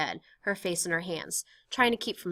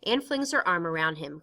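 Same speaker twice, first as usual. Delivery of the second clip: ordinary talk, nothing marked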